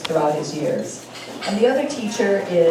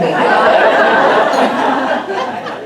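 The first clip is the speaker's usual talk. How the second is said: big burst of laughter; loud laugh; 8 semitones higher, 11 dB louder than usual. Real, not acted. Laughs at the story.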